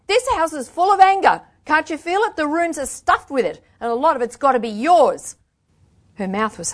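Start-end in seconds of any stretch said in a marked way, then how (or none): 0.1-5.3 high, loud, heated accusation; angry outburst. A narrator's reading. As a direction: let the pent-up anger pour out. Angry outburst after being asked.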